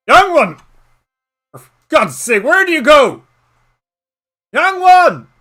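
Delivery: high, loud frantic calls; old man shouting for the youngster. Acted. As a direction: yell across the swamp for the lost child. Calling out lost companion.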